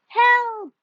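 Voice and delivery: high, loud cry for help; frightened shout. A narrator's reading. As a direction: yell as the ears spin you round. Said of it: "he shouted".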